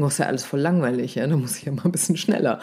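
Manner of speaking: quick, tossed-off inner monologue; hurried. Acted. Bored presenter thinking the topic is too familiar, hurrying along. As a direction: rattle it off at a brisk pace. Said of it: "deswegen sind wir manchmal so schnell".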